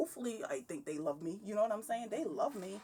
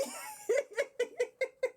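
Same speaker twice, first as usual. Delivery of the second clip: high loud laugh; 5 dB louder than usual. Real, not acted. Laugh after the joke.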